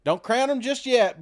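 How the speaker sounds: ordinary talk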